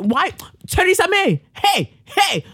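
high, mocking call after her; calling after someone. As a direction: shout after her as she runs off. Calling after fleeing Theresa May.

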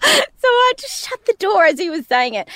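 high voice through laughter; cracking up at the memory; laughing while talking. Real, not acted. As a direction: barely get it out for giggling.

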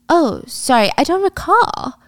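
high, drawn-out sarcastic apology; mock-sweet, stretching the last word; feigned innocence. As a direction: play dumb with a lilting high voice. Feigned innocence to angry rival.

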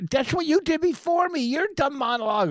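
exasperated and talking over her; indignant outburst. Real, not acted. To his co-host who cut in, a high indignant rant. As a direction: pitch jumps high as he throws her words back at her. Heated quarrel between the co-hosts.

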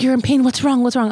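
high, loud worried mom. Retold or imitated. Mom frets over her daughter in labor, high and loud. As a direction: fret loudly over your daughter.

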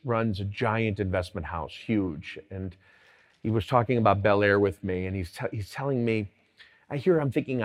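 ordinary talk

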